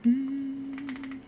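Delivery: high but very soft, a hushed teasing line; hushed flirty big-sister threat; soft sultry tease. Pretend. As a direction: coo it softly as a playful threat. Continuing the sultry voice game.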